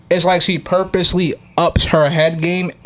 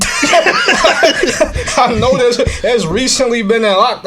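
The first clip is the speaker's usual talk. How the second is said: high and louder, slowed by laughter; laughing; 9 semitones higher, 4 dB louder, much slower than usual. Real, not acted. Bragging to friends about his girl, laughing. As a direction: drag it out through a laugh.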